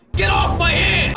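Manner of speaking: very loud, high, drawn-out yell; mock angry shout. Player jokingly scolds hand-holder, mock furious yell. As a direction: pretend to blow up at them. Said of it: "I'm just kidding".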